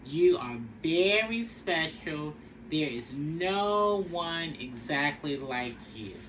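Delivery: ordinary delivery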